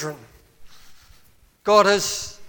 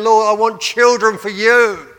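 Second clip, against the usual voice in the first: louder, raised plea; crying out to God; 4 semitones higher, 7 dB louder than usual. Acted. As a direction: call it out as a heartfelt prayer. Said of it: "crying unto God and saying".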